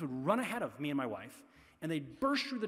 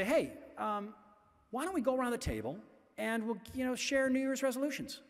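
mock cheery dad; 6 semitones higher than usual. Dad cheerfully proposing resolutions at dinner.

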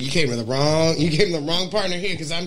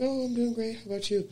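higher, sweet and chipper; high sugary mock cheer; chirpy mock reply; 7 semitones higher, 10 dB quieter than usual. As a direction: play it bright and polite. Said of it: "I was like".

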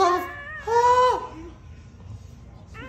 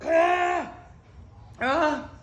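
usual speaking voice

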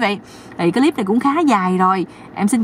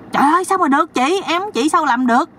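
higher voiced fake dismay; hammed-up disbelief; mock shock; 7 semitones higher than usual. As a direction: pretend to be scandalised. Brother-in-law feigns shock.

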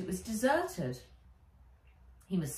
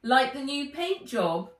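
higher, louder showing off; proud Wen; 7 semitones higher, 6 dB louder than usual. A narrator's reading. Wen shows her helmet to the camera, proud. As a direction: show off the new helmet. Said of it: "said Wen, modelling it".

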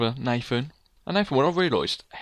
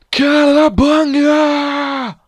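high, loud, long-drawn yell; jumping battle cry; 11 semitones higher, 9 dB louder, much slower than usual. Pretend. Player leaping off the edge, drawn-out yell. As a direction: holler it all the way down.

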